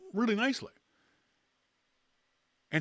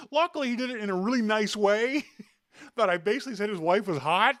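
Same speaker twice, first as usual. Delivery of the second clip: laughing through it; 8 semitones higher, 4 dB louder than usual. Real, not acted. Host laughs through the telling.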